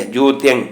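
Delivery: ਜੋਤਿੰ as jot-yang, nasal ending; half-y pronunciation. Shown as a demonstration. Teacher showing the subscript letter, half-y pronunciation. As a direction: glide from t through y in ਜੋਤਿੰ. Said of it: "ਥੱਲੇ ਯਈਆ ਵੀ ਹੈ".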